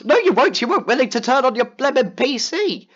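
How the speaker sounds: loud, high exasperated telling-off; scolding a teammate who won't turn on his PC; angry retort. Retold or imitated. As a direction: snap back at him, fed up.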